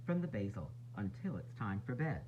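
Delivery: ordinary delivery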